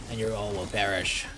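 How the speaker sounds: ominous villain proclamation; doom-laden boss voice; dark lord. Pretend. Dark Souls boss fight.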